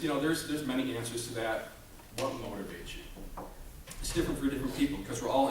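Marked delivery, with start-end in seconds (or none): 2.2-3.4 slow and soft, letting it sink in; slow question. Shown as a demonstration. Speaker asks audience slowly and quietly. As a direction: ask it slowly to make them think.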